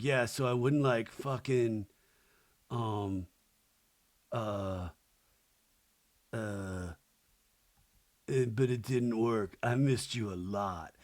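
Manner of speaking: low, halting mock-drunk voice; soft, low, hesitant tipsy guy; tipsy boyfriend. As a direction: imitate him fumbling quietly through a confession. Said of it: "a little hammered".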